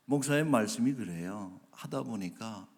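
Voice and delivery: usual speaking voice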